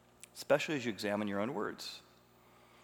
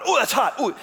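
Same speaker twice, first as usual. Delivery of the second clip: startled cry, much higher and louder; mock yelp at a hot pot; 12 semitones higher, 11 dB louder than usual. Preacher acting out touching a hot pot, startled yelp. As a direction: jerk back from the burning pot. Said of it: "you touch the pot".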